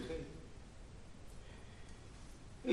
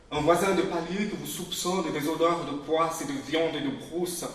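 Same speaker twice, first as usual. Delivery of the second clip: ordinary talk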